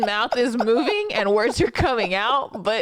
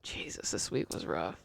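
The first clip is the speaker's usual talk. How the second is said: breathy whispered sigh of exhaustion; 14 dB quieter than usual. Real, not acted. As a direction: whisper it, worn out. Weary aside.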